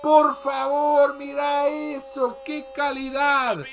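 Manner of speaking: stretched excited goal cries; breakneck goal commentary. Acted. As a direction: rave over the goal. Goal call over highlights.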